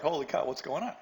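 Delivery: usual speaking voice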